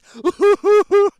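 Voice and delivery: real loud cackle; loud high cackle. Real, not acted. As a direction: burst of high, loud laughter. Cackling at his own mockery.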